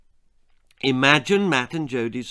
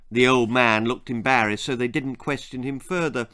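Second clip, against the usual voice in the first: ordinary delivery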